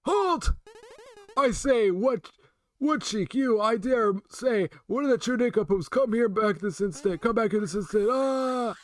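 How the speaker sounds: put-on high, slow indignant old man. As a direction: scold like a flustered old professor. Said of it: "my old man impression".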